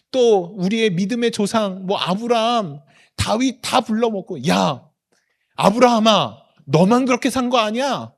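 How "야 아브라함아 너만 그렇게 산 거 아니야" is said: put-on loud, higher call to an old friend; teasing boast. God telling Abraham he is not the only one, boasting loudly. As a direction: call him out proudly and loudly. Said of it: "하나님이 천사들 다 불러 모으고".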